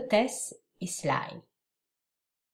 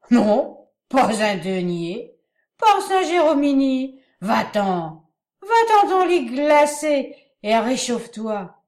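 put-on belligerent drunk; 5 semitones higher, 9 dB louder than usual. A narrator's reading. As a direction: shout down the hostess, slurring. Drunk Sly refuses to pay.

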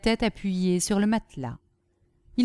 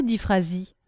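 usual speaking voice

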